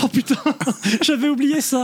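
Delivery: high, startled, laughing recall; surprised. Real, not acted. Suddenly remembers the mice.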